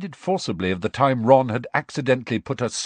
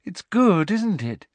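high, dreamy delight; high-pitched happy pride; 10 semitones higher than usual. Acted. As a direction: ask it with airy pleasure. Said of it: "said Luna happily".

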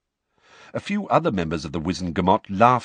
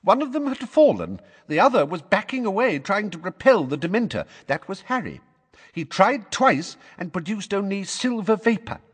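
put-on high, hurried old lady telling her story; fussy neighbour pleading her case; eager old witness; 12 semitones higher, 3 dB louder than usual. A narrator's reading. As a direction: rush through it, gaining confidence. Mrs Figg's long account.

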